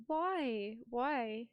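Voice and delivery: tentative British 'Why?'; British accent. Shown as a demonstration. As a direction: try out a British accent on 'Why'. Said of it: "how do you say why in a British accent".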